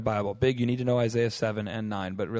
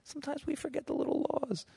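mock sheepish; 4 semitones higher, 4 dB quieter than usual. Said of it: "They weren't like".

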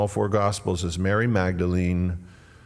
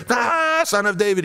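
high, loud desperate cry; screaming out; 13 semitones higher, 5 dB louder than usual. Acted. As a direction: scream it out over the crowd. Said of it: "and he's screaming".

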